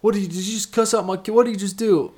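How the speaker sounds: high, fast hammed-up accusation; angry dad accusing. Retold to the co-hosts, a big angry dad accusing. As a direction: play the fuming dad who is sure his kid was cussed at.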